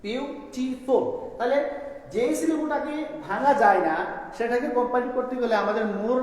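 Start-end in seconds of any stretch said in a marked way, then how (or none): 0.0-1.0 "বিউটিফুল" very slow, in three pieces; three separate syllables. Shown as a demonstration. Teacher counting three syllables, slowly broken apart. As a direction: pause between each syllable of বিউটিফুল, biu-ti-ful. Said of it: "তিনটা syllable আছে এখানে".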